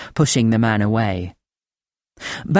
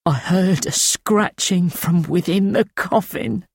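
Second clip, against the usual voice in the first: higher, thin rasping voice of a haunted man; thin rasping old voice; 7 semitones higher than usual. A narrator's reading. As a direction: rasp it out thin and frightened, pitched up. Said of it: "His voice a thin, rasping trickle".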